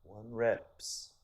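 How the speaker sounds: lightly imitated Persian accent; a hint of the trainer's Persian accent. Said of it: "heavy, heavy Persian accent".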